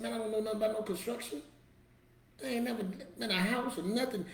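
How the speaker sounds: high-pitched retold protest. Man balking at friend's job offer, protesting.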